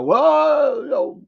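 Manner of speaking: high, loud mocking whine of a boaster; mock boasting voice. Mocking a boastful country, high and loud mock whine. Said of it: "Then boasting that".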